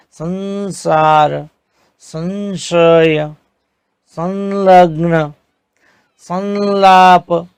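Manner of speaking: संसार and संशय said slowly, the bindu as a clear न; slow pronunciation demo. Shown as a demonstration. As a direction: drill each word slowly, nasal as न. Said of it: "जो उच्चारण है वो न के, न की तरह होता है". (अनुस्वार को न की तरह बोलते हुए धीमा उच्चारण)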